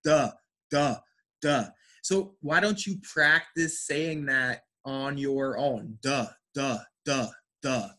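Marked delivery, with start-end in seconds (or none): 0.0-1.7 short, separate "Duh" syllables with voiced D; D sound. Shown as a demonstration. Teacher demonstrates the different sound to class, D sound. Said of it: "It is a D sound". 6.0-7.9 isolated short "Duh" repeated evenly; D sound. Shown as a demonstration. Teacher drills students to practice on their own, D sound. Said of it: "Like a D sound".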